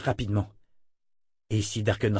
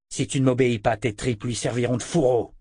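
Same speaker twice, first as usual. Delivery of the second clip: ordinary delivery, nothing marked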